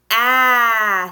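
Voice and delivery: long, loud "A" as in cat; stretched aaa, not the letter name; short A sound. Shown as a demonstration. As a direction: hold "A" as the short vowel aaa. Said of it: "I'll say the sound".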